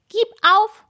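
very high, squeaky little hat voice; talking hat calling out. A narrator's reading. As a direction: pipe up as a tiny talking hat. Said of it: "rief ihr Hut". (piepsig hoher Ruf des Hutes)

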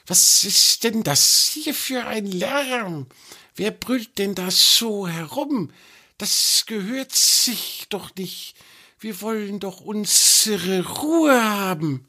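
high, slow, hissing snake voice, irritated; a small creature scolding the noise from the dark; annoyed little snake. A narrator's reading. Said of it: "erkennbar ärgerlich".

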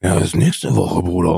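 low, slow, laid-back bro; lightly voiced careless buddy; lazy buddy voice. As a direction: drawl it out like a careless friend. Friend's reply retold.

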